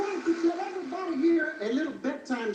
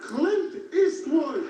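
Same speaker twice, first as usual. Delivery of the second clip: slow, loud punchline reveal; 2 semitones higher, 5 dB louder, much slower than usual. Acted. Comedian hitting the punchline slowly and loud. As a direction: land the name slowly and loudly.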